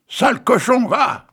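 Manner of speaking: high, loud indignant cry; angry outcry. Acted. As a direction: spit it at the ungrateful pig. Girl's angry outburst at pig. (cri indigné, plus aigu et plus fort)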